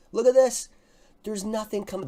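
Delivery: usual speaking voice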